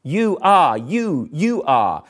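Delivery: each "you" punched and set apart; emphatic "you". Shown as a demonstration. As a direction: repeat it, hammering "you". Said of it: "the emphatic "you" starts each phrase".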